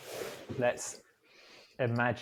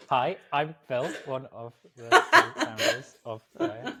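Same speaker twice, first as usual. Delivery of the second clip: mock staccato intro, higher and louder; awkward staccato; 4 semitones higher, 7 dB louder than usual. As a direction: exaggerate a stilted, word-by-word podcast introduction. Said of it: "that slightly weird staccato, awkward".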